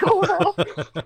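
high, laughing panic; overwhelmed, laughing in disbelief; freaking out. Retold or imitated. Singer telling Jeff the news, laughing high panic. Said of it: "Whoa, whoa, calm down".

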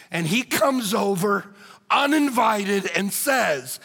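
ordinary talk

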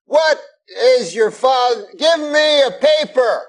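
high, slow put-on Cosby voice; Bill Cosby impression. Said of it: "And, uh, he goes".

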